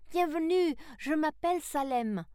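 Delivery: high, loud welcome; boy calling out. A narrator's reading. Salem greets Sarah and Ario, calling out high. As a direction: call out a greeting in a high boy's voice. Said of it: "cria l'un des garçons".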